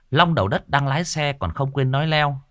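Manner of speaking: plain reading voice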